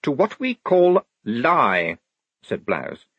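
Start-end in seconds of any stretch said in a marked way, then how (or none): 0.0-2.0 higher, slow and deliberate officer; officious young lieutenant. Acted. Lieutenant explaining his plan to the squad, officious. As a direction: announce the plan with officerly precision.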